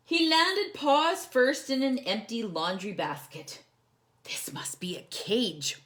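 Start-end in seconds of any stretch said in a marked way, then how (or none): 4.2-5.8 whispered with wonder. Acted. Corduroy peeking through the basket bars, whispered. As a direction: breathe it to yourself, peeking.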